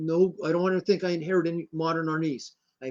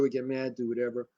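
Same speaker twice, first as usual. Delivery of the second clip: ordinary talk, nothing marked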